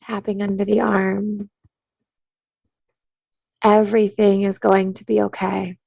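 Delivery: soothing meditation-teacher cadence; guided tapping meditation. Acted. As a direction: calmly guide them to tap and breathe.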